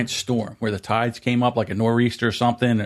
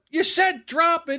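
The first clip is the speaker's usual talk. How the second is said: high, raised accusing voice; heated argument; slower than usual. Retold or imitated. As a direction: argue back hard with dad. Acted quarrel with dad.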